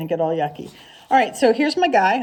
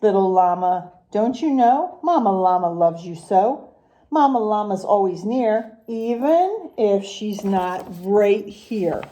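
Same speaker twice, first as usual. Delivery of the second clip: sing-song rhyming picture-book read, unhurried; reading to children; slower than usual. Acted. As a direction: read the rhymes to the class with a lilt. Teacher reads picture book aloud.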